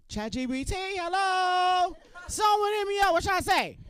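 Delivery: high loud panicked plea to the phone; panicked call to the AI; frantic shout. Acted. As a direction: yell at the chatbot for help. Panicked asking ChatGPT.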